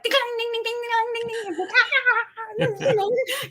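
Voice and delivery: high, rapid ding ding ding like a desk bell; imitated bell dinging. Juggling the DMV bell, high bell-like dinging. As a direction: mimic a desk bell ringing over and over.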